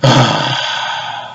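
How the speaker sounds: long, heavy exhale; exasperated sigh. Real, not acted. Fan giving up over the cut, exasperated sigh. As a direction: let out a long, defeated sigh.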